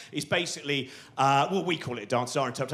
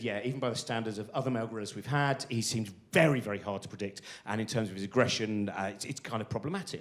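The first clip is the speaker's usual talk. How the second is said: low, soft, fast deadpan zookeeper voice; a hushed, hurried keeper's confession; imitating zookeeper; 7 semitones lower, 6 dB quieter than usual. Zookeeper quoted in pub story.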